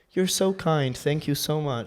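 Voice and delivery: lightly mimicked older lady; older lady's accent. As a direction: pitch up into the old lady's thanks. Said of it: "like, that old, like, older accent".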